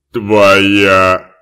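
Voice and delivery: loud creature call; booming one-word shout. Acted. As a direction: point him to the door. Creature points out door.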